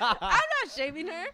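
laughing denial. Real, not acted.

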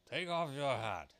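high, loud king; slow royal command. A narrator's reading. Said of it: "the king said".

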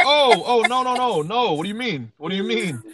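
hammed-up panic, backpedalling fast; mock alarm. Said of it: "I was like".

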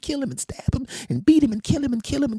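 hammed-up woman ranting herself into a frenzy; vengeful changed woman's voice. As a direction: run away with a breathless list of threats in a high female voice. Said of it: "her voice went from this to this".

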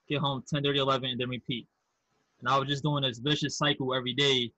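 usual speaking voice

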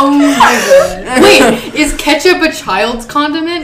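loud laughing disbelief. Real, not acted. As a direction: crack up mid-question. Laughing at question.

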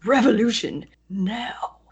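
slow, raspy whispered slogan. Pretend. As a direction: breathe it out like a ninja. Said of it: "Yeah, see?".

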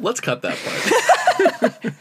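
slow, laughing through words; giggling while asking to cut. Real, not acted. Laughing over embarrassing clip.

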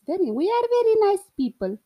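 put-on playful Indian accent, high, loud and fast. Joking about accents, playful Indian accent. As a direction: imitate an Indian accent on very nice people. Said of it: "This is more the Indian accent".

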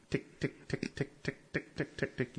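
soft unvoiced clicking ticks; whispered ticking. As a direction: tick it out under your breath like interference. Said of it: "this shit goes tick".

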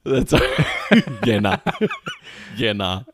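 slow, laughing drawn out. Real, not acted. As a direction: stretch it out laughing.

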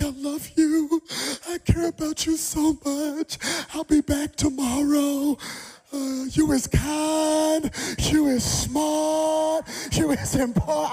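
high, loud, slow weepy farewell; tearful goodbye. Retold or imitated. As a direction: sob it out to the baby. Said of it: "I'm like".

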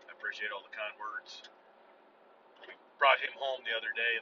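real grief over the lost dog, choked down; holding back tears. Real, not acted. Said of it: "I don't wanna cry".

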